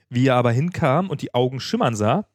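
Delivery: usual speaking voice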